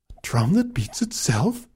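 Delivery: hushed, astonished whisper; whispered disbelief. Acted. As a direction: breathe the question in disbelief. Stunned repetition of demand.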